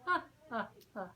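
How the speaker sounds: a light, voiced chuckle; ha-ha-ha with a gentle laugh; chuckling. Albatross amused by Pip's wish, chuckling lightly. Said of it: "The albatross chuckled".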